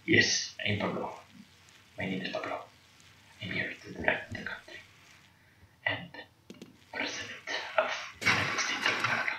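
very soft, husky put-on hero voice; Pablo. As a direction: murmur it low, with solemn pauses. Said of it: "I'm gonna talk like this".

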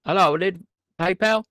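loud, high call into the phone; hamming up the caller; loud phone greeting. Acted. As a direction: call out a bright hello down the line. Calling scammer on phone.

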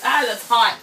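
normal talking voice

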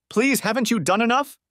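begging her husband to stop; high tearful plea; pleading. Acted. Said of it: "she pleaded".

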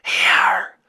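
loud, breath-only dragon hiss. A narrator's reading. Dragon answering the boy, hissing. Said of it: "hissed the dragon".

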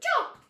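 high squeaky "chop!"; chop sound effect. Pretend. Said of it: "I'm like".

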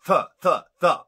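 "Th, th, th" punched out loud, one by one; emphatic TH sound. Shown as a demonstration. Host drills English speakers, loud pointed "Th" sounds. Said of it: "We know how to pronounce th".

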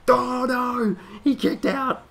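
high, loud play-by-play call; shouted wrestling commentary. Pretend. Gamer calling his own wrestling match, excited shout. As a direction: yell it like a ringside announcer losing it.

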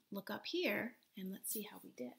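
soft whispered invitation to look up. Real, not acted. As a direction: hush it like telling a class secret. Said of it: "teacher whisper".

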